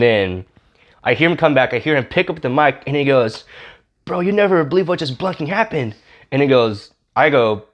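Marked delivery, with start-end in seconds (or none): 4.0-5.9 high-pitched excited retelling; mock amazed outburst, voice pitched up; acted astonishment. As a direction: imitate a guy bursting with unbelievable news. Retelling healed man's stunned report.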